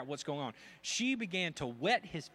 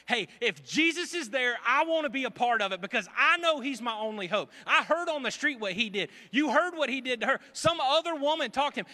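louder, higher, urgent hope; eager sinful woman; 7 semitones higher, 8 dB louder than usual. Retold or imitated. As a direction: burst in pleading to be near Jesus. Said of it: "walked in that house and said".

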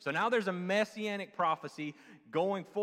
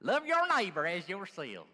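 thick Southern accent, put on for fun; slow Southern drawl; 4 semitones higher, 6 dB louder than usual. Preacher imagining Jesus with a Nazareth accent, playful Southern drawl. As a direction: exaggerate a country Southern drawl, a bit louder. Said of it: "that slow southern drawl".

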